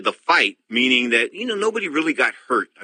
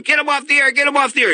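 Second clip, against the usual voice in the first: much higher, louder retold yell of the producer; mock yell; 14 semitones higher, 3 dB louder than usual. Host retelling the producer screaming in his ear, mock yell. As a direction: bark it like a panicked producer in the earpiece. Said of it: "the producer's screaming in my ear so loud".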